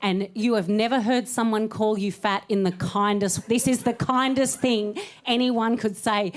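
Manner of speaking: normal talking voice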